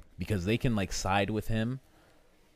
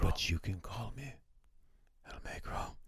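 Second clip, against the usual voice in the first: quiet voiceless villain introduction; menacing whisper; 8 dB quieter than usual. Retold or imitated. The avenging son introduces himself, whispered. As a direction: whisper it like a killer at the door.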